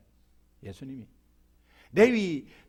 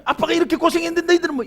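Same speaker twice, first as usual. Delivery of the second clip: complaining father; 7 semitones higher, much faster than usual. Retold or imitated. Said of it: "이렇게 하잖아요".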